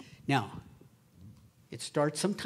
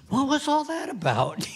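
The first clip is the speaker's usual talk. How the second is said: higher, louder, laughing through it; laughing baffled question; 10 semitones higher, 6 dB louder than usual. Retold or imitated. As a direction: laugh while asking, bewildered. Evangelist baffled.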